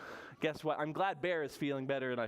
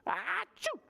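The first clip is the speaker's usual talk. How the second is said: high, theatrical achoo; pretend sneeze. Storyteller acts out sick Bear, high pretend sneeze. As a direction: fake a big storybook sneeze.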